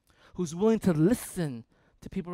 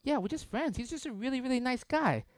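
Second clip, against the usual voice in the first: higher, lightly voiced girl; high girly voice; 8 semitones higher than usual. Girl defending her friend in a higher voice. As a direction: play the naive girl, higher. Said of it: "It's like".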